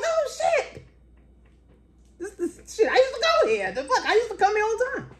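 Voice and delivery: high, laughing cries of disbelief; cracking up at the coincidence. Retold or imitated. Telling the guard she used to come here, laughing high. Stunned at old school.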